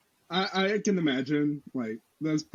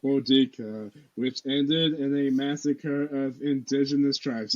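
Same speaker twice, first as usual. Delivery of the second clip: utterly bored classroom read-aloud; most unenthused voice. Shown as a demonstration. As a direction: read it like you're dying on the inside. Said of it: "the most unenthused voice ever".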